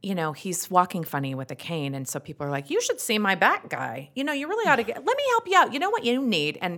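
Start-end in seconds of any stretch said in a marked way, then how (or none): 2.7-6.6 high, eager imitation of advice-givers; pushy helpful acquaintances. Wife imitating people offering back cures, pushy and high. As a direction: pile on the unwanted advice brightly. Acting out pushy well-meaning advisers.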